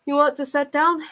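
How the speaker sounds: hint at a voice coming through headphones; crackly headset voice. A narrator's reading. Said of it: "crackled through her headphones".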